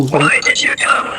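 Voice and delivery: hushed unvoiced whisper, slow. Real, not acted. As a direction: whisper it so the ghost alone hears. Calling to a ghost at night.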